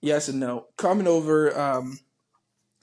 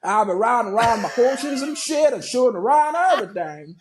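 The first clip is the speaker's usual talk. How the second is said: high-pitched, exaggerated Texan cowboy accent; 7 semitones higher, 4 dB louder than usual. Shown as a demonstration. Cowboy talking horses and shooting, exaggerated Texan accent. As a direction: ham up a loud Texan cowboy. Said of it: "the Texan accent".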